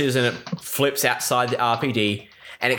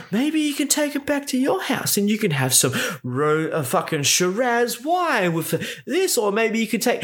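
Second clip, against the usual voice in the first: lightly raised, higher rambling mock voice; mocking ramble; 7 semitones higher than usual. Mildly mocking the chief's two-minute ramble. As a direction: babble on in a mildly silly voice. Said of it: "a two-minute ramble going".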